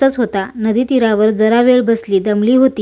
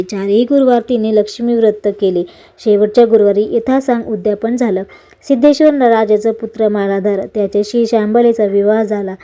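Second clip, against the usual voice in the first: ordinary delivery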